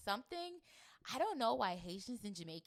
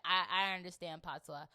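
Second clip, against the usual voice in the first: normal talking voice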